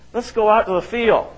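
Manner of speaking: lightly put-on sly Cain. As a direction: coax him out sweetly. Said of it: "Cain said to his brother Abel".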